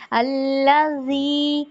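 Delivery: अल्लाजी with the tongue peeking just past the teeth; tongue slightly out. Shown as a demonstration. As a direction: let the tongue show a little on अल्लाजी. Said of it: "थोड़ी सी बाहर को भी झांकेगी". (अल्लाजी ज़ुबान हल्की बाहर)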